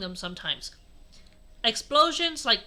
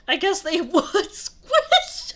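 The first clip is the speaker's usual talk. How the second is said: loud, high, helpless giggling; laughing; 7 semitones higher, 7 dB louder, slower than usual. Real, not acted. Reader tickled by the story's word choice, laughing hard. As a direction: talk through real laughter.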